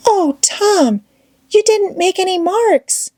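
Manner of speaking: high, tearful Becky cry. A narrator's reading. Becky realises they're lost.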